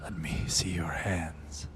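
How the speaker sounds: hushed, solemn and low; solemn near-whisper. Acted. Oberon asks to see Yuki's hands, solemn near-whisper. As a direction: drop to a grave, whispery undertone. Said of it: "nods solemnly and says".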